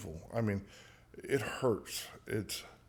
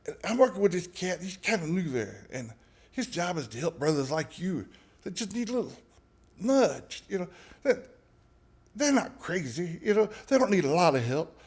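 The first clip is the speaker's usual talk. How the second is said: higher, smooth-talking New Orleans patois; 6 semitones higher than usual. Retold or imitated. Lucas talking him into helping a new guy, New Orleans patois. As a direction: sell it like a persuasive New Orleans hustler.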